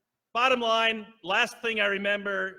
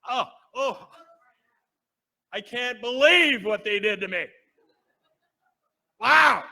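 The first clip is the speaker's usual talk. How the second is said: amazed; 4 semitones higher, 10 dB louder than usual. Retold or imitated. Amazed watching surgery video.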